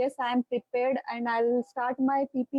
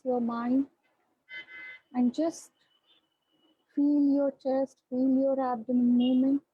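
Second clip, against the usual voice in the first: guided meditation. Acted. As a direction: ease them into their breath, unhurried. Leads a guided breathing meditation.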